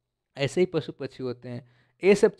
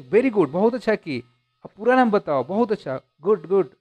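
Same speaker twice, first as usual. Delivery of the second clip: higher, louder praise; encouraging; 5 semitones higher, 3 dB louder than usual. Acted. Teacher urges the girl to say her full name, encouraging and higher. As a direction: cheer the child on, lifted and eager. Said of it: "उन्होंने थोड़ा encourage किया उसको".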